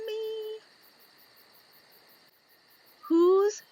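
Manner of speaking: high squeaky bee voice; a tiny curious bee peeking out; little bee voice. Acted. Young bee asks the reader, high and curious.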